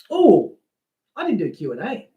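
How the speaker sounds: a jolt of surprise, rising at the end; sudden realisation. Real, not acted. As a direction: catch yourself, Oh, I forgot. Suddenly remembers forgotten Q and A.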